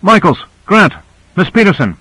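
high-pitched hail to missing crewmates; calling out names. A narrator's reading. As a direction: call each name out into the distance. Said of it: "Owens called".

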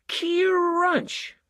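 high, loud gleeful "Crunch!". A narrator's reading. Susan gleefully voicing the elm crashing onto their sedan. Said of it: "said Susan".

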